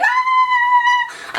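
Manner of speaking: high, loud shocked shriek; startled scream. Retold or imitated. Onlookers scream as the punch lands. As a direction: shriek in sudden fright. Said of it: "everyone was just like".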